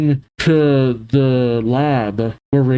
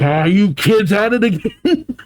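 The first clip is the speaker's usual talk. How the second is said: higher, hammed-up angry growl-up; mock angry; 7 semitones higher than usual. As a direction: play an exasperated outburst at kids. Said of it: "And it gets angry".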